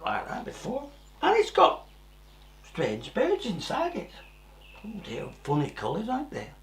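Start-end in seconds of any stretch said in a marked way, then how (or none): none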